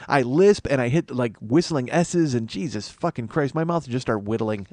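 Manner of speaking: usual speaking voice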